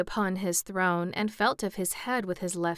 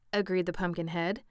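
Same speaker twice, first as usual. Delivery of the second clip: ordinary delivery, nothing marked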